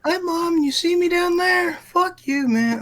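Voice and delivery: squeaky high cartoon voice; silly high voice. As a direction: clown it up in a squeaky tone. Guest joking high silly voice.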